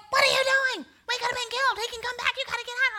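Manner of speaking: husband yelling at his wife to get out of the house; alarmed husband. Retold or imitated. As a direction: play the husband shouting in disbelief she's going to bed. Husband scolds calm wife.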